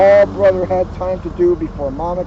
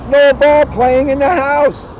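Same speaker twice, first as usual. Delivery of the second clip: slow, loud and high, rule laid down; emphatic rule; 8 semitones higher, 8 dB louder, slower than usual. A narrator's reading. Reader stressing a family rule to children, slow and emphatic. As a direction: lay down the law slowly.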